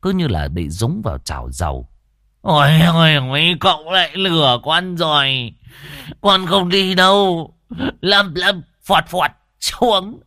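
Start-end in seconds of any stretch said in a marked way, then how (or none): 2.4-10.2 high, loud panicky pleading; a scared old man refusing; frightened protest. Acted. Frightened character protests loudly.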